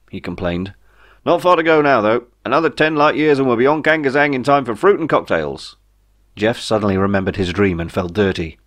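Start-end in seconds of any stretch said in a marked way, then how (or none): 1.3-5.8 higher, chirpy alien pilot voice; a cheery alien voicing his welcome; Ray the alien. Ray talks about the journey.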